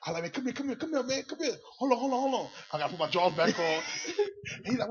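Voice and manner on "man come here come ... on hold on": hushed, high-pitched calling over; retold urgent summons. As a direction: beckon a friend urgently but softly. Retold furtive call to his homeboy.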